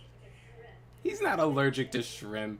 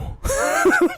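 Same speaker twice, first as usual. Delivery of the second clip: loud high laugh; 13 dB louder than usual. Real, not acted. Laughing at own retelling.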